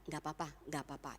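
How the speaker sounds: fast, soft brushing-off mumble; quick mumbled dismissal. Retold or imitated. Preacher mimics believers excusing a wrong, quick soft mumble. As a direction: wave it away under your breath, quickly.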